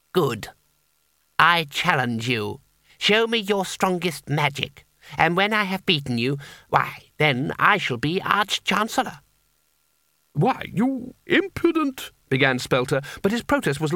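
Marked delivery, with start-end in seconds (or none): 0.1-9.2 put-on slow, deliberate, higher child's voice; challenging boy. Boy challenges the wizard for leadership, slow and deliberate. 10.3-12.1 very high, drawn-out indignation; indignant outburst. Acted. Spelter protests at the boy's challenge, high and indignant.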